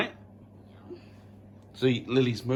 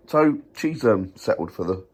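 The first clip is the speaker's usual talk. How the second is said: ordinary talk, nothing marked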